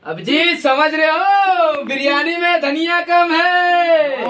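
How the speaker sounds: high, slow sing-song proclamation; hammed-up inspector drawl; TV detective parody. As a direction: stretch each phrase as a momentous clue. Detective parody in filmed take.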